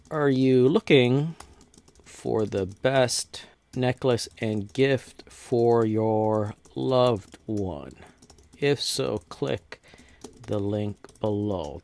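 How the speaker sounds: words drawn out slowly as if typing them; slow dictation. Shown as a demonstration. Tutor typing an ad script for viewers, slow dictation.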